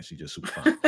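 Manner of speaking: whispered admiring aside; breathy aside. Real, not acted. Gazing at Effie's picture, whispering admiration. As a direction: whisper it under your breath.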